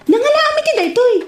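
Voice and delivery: usual speaking voice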